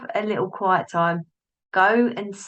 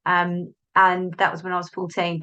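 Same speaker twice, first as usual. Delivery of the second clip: ordinary talk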